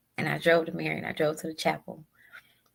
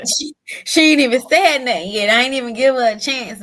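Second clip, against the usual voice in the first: high, loud, laughing at herself through tears; real tearful laugh, louder and higher; 5 semitones higher, 7 dB louder than usual. Real, not acted. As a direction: burst out half laughing, half crying.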